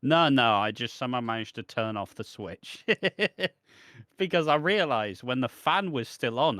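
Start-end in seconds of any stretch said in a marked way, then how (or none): none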